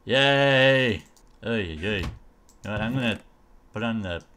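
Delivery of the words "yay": loud, drawn-out yay; cheering. Real, not acted. Player pleased with the armour, loud long cheer.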